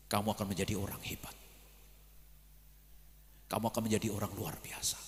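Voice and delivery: softly imitating a moved teacher, trailing into breath; hushed, quick words of encouragement, mildly emotional; retold tearful teacher. Teacher encouraging the student who walked without money, hushed and moved. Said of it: "Guru saya nangis".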